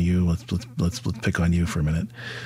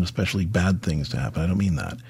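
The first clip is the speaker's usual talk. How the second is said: ordinary talk, nothing marked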